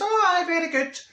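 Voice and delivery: very high mock approval; sarcastic. Patient scoffs at the dentist off boating, sarcastic. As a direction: sing out false approval, pitched right up.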